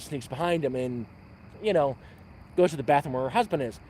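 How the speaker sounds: ordinary talk, nothing marked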